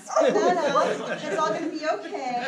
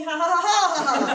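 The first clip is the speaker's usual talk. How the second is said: mock laugh; 4 semitones higher, 6 dB louder than usual. Fake laugh in vampire scene.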